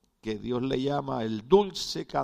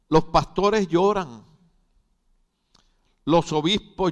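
loud, raised pulpit proclamation; forceful preaching; 4 semitones higher, 7 dB louder than usual. Real, not acted. Preacher defending pastors who weep, forceful preaching. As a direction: drive each statement out to the congregation.

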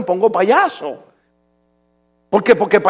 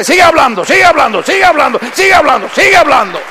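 loud repeated urging, higher; God urging loudly; 5 semitones higher, 5 dB louder than usual. Acted. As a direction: push him on, louder each time. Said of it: "Dios me dice". (Dios le dice que siga, con fuerza)

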